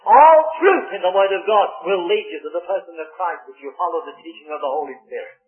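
ordinary talk, nothing marked

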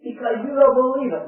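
rising, loud gospel appeal; urgent revival preacher pleading for belief; forceful preaching. Real, not acted. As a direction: build to a ringing challenge.

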